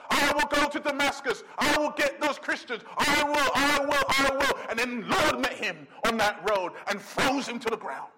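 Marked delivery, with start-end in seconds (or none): 0.1-4.7 higher, insistent boasting; Paul, full of himself. Acted. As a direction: act out a proud man's stubborn vows. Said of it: "once he's full of himself".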